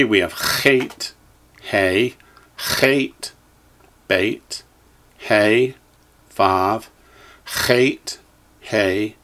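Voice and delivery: letters read one by one with pauses; measured list reading, rising on each item; echo drill. Shown as a demonstration. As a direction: call out each letter for students to repeat, lilting up until the last. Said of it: "you echo me".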